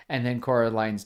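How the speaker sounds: normal talking voice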